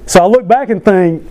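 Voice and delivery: normal talking voice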